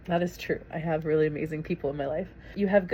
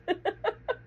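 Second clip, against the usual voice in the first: a quick high burst of laughter; 14 semitones higher, 4 dB quieter than usual. Real, not acted. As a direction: laugh at the joke about cursing. Laughs at the truck driver line.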